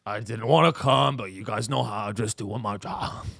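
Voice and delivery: a half-hearted cop shrugging it off; a hint of a bored cop who didn't want to come; lazy cop.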